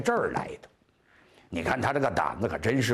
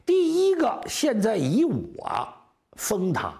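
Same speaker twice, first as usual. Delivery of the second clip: lightly acted, high lordly voice; a hint of an amused ruler; scoffing emperor; 8 semitones higher, slower than usual. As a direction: count off the objection in a high, measured voice. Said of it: "周天子乐了".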